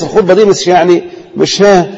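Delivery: normal talking voice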